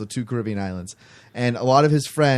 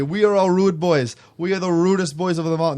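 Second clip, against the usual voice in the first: mock Jamaican accent; 5 semitones higher than usual. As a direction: announce it grandly in a put-on Jamaican accent. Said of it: "look at the waiter and say".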